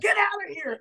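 high-pitched yell without much volume; angry bar owner. Acted. Bar owner swinging at Kevin, angry high yell. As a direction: yell it in a pushed-up, furious pitch.

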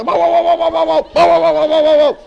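man yapping like a dog; dog barking. Pretend. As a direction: bark it out like a hound.

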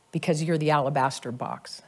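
"alabaster box" dropped low and soft; softly spoken. Acted. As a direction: let it fall gently, almost to yourself. Prophecy relayed, softly then proclaimed.

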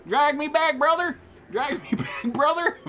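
very high, comic begging; high-pitched plea breaking into a laugh; pleading cry. Real, not acted. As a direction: beg a teammate to rescue you. Downed, begs teammate for rescue.

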